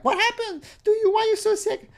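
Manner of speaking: put-on very high falsetto girl; mock girly voice. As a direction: squeak like a silly girl. Reading chat as the girl.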